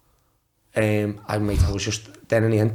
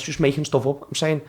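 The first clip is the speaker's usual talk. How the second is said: ordinary talk, nothing marked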